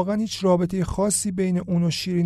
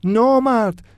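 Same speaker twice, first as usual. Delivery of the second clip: loud, higher cry of نامرد with a falling end; shouting; 6 semitones higher, 6 dB louder, slower than usual. A narrator's reading. Iman shouting at the bully who broke his violin. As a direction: yell نامرد in shock. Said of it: "فریاد میزد".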